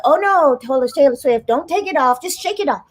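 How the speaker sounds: high-pitched comic advice from a know-everybody character; Etta Mae. Acted. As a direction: raise the pitch and playfully correct the pop star. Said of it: "And Etta Mae said".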